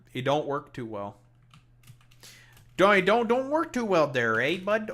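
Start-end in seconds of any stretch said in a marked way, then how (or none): 2.8-4.9 loud, high, hammed-up Canadian; extra Canadian accent. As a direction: lay the Canadian accent on thick. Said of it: "being a bit extra Canadian".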